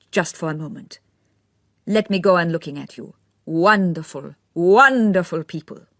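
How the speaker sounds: spoken through a smile, savouring each word; affected young man. A narrator's reading. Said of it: "every syllable received rather more emphasis".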